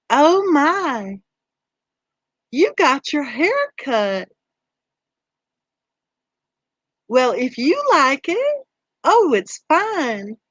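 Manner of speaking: high, slow sugary Southern drawl. Shown as a demonstration. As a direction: drag out each word in a sweet Southern drawl as a polite dig. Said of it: "say it with your Southern drawl".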